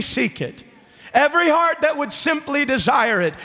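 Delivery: high, urgent pulpit delivery; impassioned sermon voice, pitched well up; full-force preaching. Real, not acted. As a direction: preach it with rising fervor.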